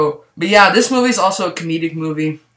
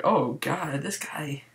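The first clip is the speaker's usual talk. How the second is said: whispered aside; 9 dB quieter, much slower than usual. Retold or imitated. Own reaction in theater.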